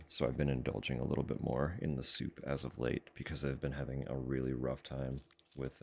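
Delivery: usual speaking voice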